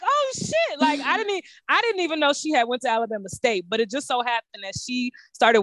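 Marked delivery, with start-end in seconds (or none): 0.0-0.8 high startled "oh shit"; mock shock at recognising her; surprised. Retold moment of recognising a classmate, high and startled.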